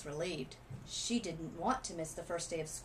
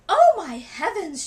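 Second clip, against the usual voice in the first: high, loud shocked outcry; panicked mother crying out; alarmed cry; 10 semitones higher, 18 dB louder, slower than usual. Acted. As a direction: gasp it out in horror, slowly. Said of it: "she cried".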